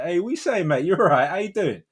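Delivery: high, matey Cockney greeting. Shown as a demonstration. Geezer greeting his mate, in Cockney. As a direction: put on a chummy Cockney lilt. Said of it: "I can have the Cockney".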